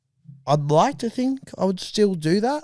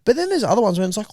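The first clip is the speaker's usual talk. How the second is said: ordinary delivery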